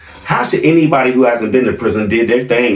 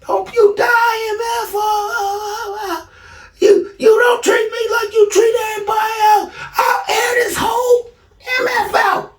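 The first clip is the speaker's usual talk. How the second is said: very high, crying shouted mimicry; son yelling curses. Son yelling curses as his father walks into the house, high crying scream. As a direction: scream it high through tears. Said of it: "He proceeds to start yelling at me".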